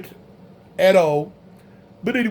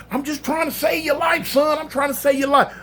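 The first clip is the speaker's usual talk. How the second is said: high, raised mock shout of the cop; cop shouting back; 7 semitones higher than usual. Cop shouting at the inmate that he is saving his life.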